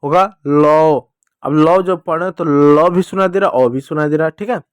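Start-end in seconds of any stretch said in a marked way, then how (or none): 0.0-1.1 slow, louder होगा लो; slow demo syllable. Shown as a demonstration. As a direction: draw out लो. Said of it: "औ की मात्रा लगेगा". (धीरे-धीरे लो)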